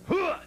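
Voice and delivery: high, loud whoop; flasher's whoop. Retold or imitated. Flasher flashing the nun again, high loud whoop. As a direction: jump out with a loud hooah.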